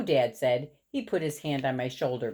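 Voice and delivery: ordinary delivery, nothing marked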